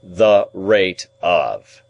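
very slow, each word set apart; deliberate repetition. A narrator's reading. Lawyer repeats his question to his ward, very slow and deliberate. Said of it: "repeated Mister Jaggers, still looking at the ceiling".

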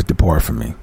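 low, softly delivered dismissal; God's rejection. Acted. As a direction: lightly voice a solemn divine verdict, low. Voices God's final rejection.

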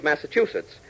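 normal talking voice

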